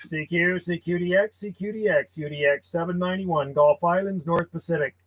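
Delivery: measured, quiet call-sign read; radio CQ call. Acted. Ham operator calling CQ on the radio, steady call-sign delivery. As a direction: call out steadily over the air.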